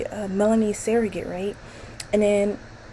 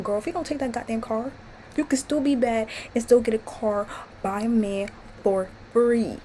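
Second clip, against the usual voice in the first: usual speaking voice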